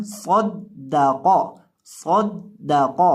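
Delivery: सौद दा कौ with the d held doubled; firm grip on d. Shown as a demonstration. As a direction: press firmly on the middle d in सौद दा कौ. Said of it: "इसके ऊपर गिरफ्त करके पढ़ना है". (صَدَّقَ دال پر مضبوط گرفت کے ساتھ)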